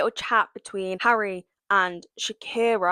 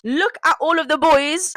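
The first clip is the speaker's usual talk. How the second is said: mock host voice; 6 semitones higher, 4 dB louder than usual. Retelling the show's setup, mock announcer.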